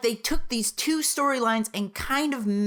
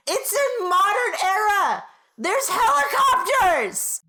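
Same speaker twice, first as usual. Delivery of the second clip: outraged ranting; 9 semitones higher, slower than usual. Real, not acted. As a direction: rant in disbelief, voice cracking. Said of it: "I'm just gonna yell at the camera".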